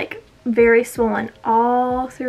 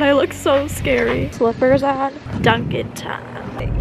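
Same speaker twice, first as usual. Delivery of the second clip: usual speaking voice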